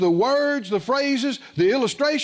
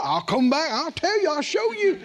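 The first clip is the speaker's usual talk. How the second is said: rising higher, defiant promise; he won't back down, climbing in pitch; pushy insistence; 7 semitones higher than usual. Retold or imitated. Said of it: "he was pushy about it".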